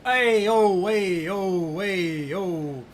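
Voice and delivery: hammed-up fake Italian singing chant; mock Italian sing-song calls. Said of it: "So I can do fake Italian bullshit".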